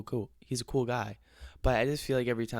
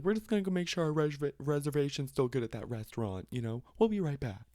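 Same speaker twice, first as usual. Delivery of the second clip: mock parent voice; 6 semitones higher than usual. Parents' excuse mocked.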